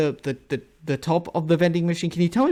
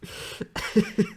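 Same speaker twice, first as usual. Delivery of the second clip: laughing; 4 semitones higher, 6 dB quieter than usual. Real, not acted. Reacts to the clip.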